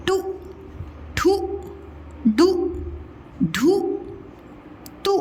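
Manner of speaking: each of कु, खु, गु a short, rising u; short-u syllable drill. Shown as a demonstration. Teacher models short-u syllables for practice, short rising drill. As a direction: drill कु, खु, गु one by one with clipped short u. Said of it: "इसी की बहुत अच्छे से practice करनी है".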